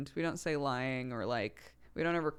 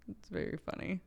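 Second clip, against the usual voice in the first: ordinary delivery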